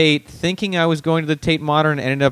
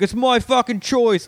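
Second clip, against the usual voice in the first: high mocking cab driver voice, slower; mock the angry cabbie in a high put-on voice; imitating a huffy London cabbie; 11 semitones higher, slower than usual.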